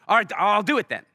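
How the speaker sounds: playing his younger self, caving in with a high, loud sigh of surrender; reluctant giving in. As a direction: give in with a loud, put-upon all right. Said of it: "And I was like".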